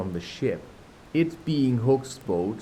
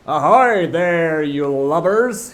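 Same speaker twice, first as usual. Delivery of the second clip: slow, loud, high pirate captain hail; Hook imitation; 6 semitones higher, 7 dB louder, slower than usual. Peter hailing the pirates as Captain Hook. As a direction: call out like the captain. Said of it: "imitated the voice of Hook".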